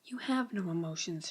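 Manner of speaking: whispered under the breath; Jeremy whispering back at the Squip. Acted. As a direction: hiss it privately to the voice in your head.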